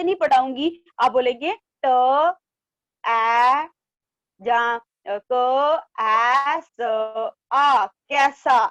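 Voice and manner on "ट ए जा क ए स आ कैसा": each sound drawn out separately, "ट, ए" before joining; spelled out slowly. Shown as a demonstration. Teacher showing class how not to read, "क, ए, स, आ" dragged apart. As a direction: break "कैसा" into long single sounds "क, ए, स, आ". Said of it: "ऐसे नहीं बोलेंगे आप".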